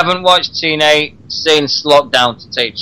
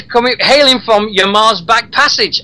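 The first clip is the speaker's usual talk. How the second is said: referee's announcement; 6 semitones higher than usual. Retold or imitated. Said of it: "he just says".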